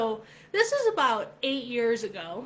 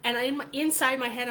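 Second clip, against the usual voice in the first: normal talking voice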